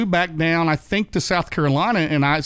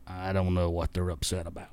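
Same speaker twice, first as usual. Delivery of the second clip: lower, softer voice, a touch of playing dumb; mildly voiced sheepish old racer, lower and softer; sheepish innocence; 8 semitones lower, 11 dB quieter than usual. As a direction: act like you can't see why they're upset. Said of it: "he had that sheepish, sheepish grin".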